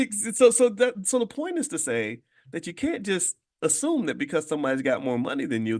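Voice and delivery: normal talking voice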